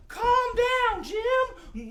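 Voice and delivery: very high, drawn-out call; high-pitched echo. Pretend. Echoing for the children calming the dog, high call. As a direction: repeat it in a high head voice.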